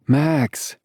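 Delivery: a high, scolding name; chastising. Acted. Winnie scolding her friend Max, high chastising tone. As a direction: warn him off with just his name. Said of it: "Winnie chastises".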